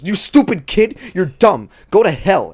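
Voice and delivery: loud, high mascot tirade; abusive Ronald McDonald. Acted. Ronald berating a kid who fell out, angry mock yell. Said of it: "Ronald comes up and says".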